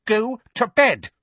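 high, loud, slow command; exasperated order. Acted. Aunt refusing to answer her nephew, ordering angrily. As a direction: space out the words in anger.

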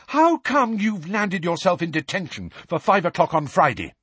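high, indignant telling-off; angry demand. A narrator's reading. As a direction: scold him like an angry captain. Angelina confronts Harry.

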